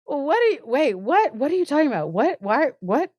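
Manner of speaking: acted flustered disbelief; mock bewilderment. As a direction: fire off baffled questions. Bewildered guest firing questions, acted.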